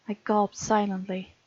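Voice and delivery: ordinary talk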